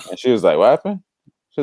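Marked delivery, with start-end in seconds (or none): 0.6-1.0 surprised mom. Retold or imitated. Mom surprised by son's prayer.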